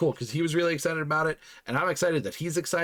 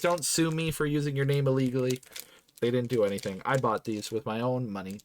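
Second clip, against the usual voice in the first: ordinary talk, nothing marked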